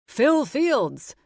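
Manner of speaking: loud hearty announcement; booming. Acted. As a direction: boom out your own name. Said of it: "my dad booms out".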